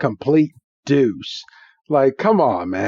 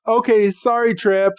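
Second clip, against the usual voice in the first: put-on higher, sheepish kid voice; meek apology; 7 semitones higher than usual. Ash apologizes meekly to Trip.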